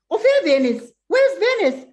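loud, very high-pitched mock mom; puzzled mother. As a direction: imitate a baffled mother exclaiming. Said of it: "my mom's like".

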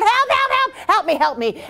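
high, panicked cries fading out; yelled for help. A narrator's reading. Emperor crying for help as the snake strangles him. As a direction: cry out as the snake squeezes. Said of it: "And he yelled".

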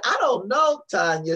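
laughing through the words, high and bright. Real, not acted. Telling Kimberly the story, through a laugh. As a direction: keep laughing as you say it. Retelling her reply, still laughing.

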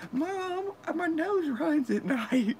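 imitating a whiny teen friend; whiny kid voice. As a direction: squeak it out like a sniffly kid. Friend retold whining to mom.

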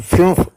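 "ഫ്യൂംഫ്" with a fronted u; German umlaut pronunciation. Shown as a demonstration. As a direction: demonstrate "ഫ്യൂംഫ്" as German fünf. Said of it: "ഇങ്ങനെയാണ് ഉച്ചാരണം". ("ഫ്യൂംഫ്" German ഉച്ചാരണത്തിൽ)

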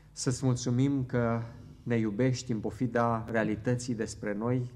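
ordinary talk, nothing marked

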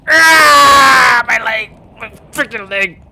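yelling in pain from a bitten leg; pained shout. Pretend. Whale attacking him, leg.

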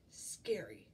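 low, slow, suspenseful description; ominous narration. A narrator's reading. Reader introduces the hungry giant, ominous and slow.

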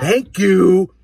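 high, loud cheeky thanks; smug thank-you. Retold or imitated. Boy thanking mom for believing him, high loud cheek. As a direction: rub it in. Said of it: "I looked at it and I went".

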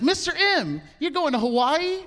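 high-pitched little second grader; high kid voice. Retold or imitated. Second grader asking his teacher, in a high kid voice. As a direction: pipe up like an eager little kid. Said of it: "He's like".

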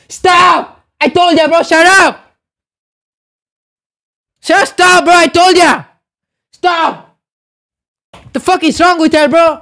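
high, forceful angry protest; mad scolding. Real, not acted. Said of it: "this jigga is making me so mad".

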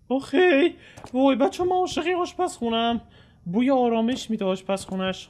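high gushing delight. Real, not acted. Gamer gushing over a cozy kitchen, high delighted voice. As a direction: gush about the cozy kitchen.

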